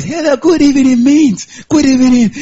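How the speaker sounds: put-on show-off voice for good evening; mock fancy voice. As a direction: greet as if you have a great voice. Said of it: "You think you have good voice".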